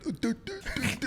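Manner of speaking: a stammering interview answer, lightly mocked; mock stutter. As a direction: imitate a stuck stutter on do, do, do. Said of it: "He like".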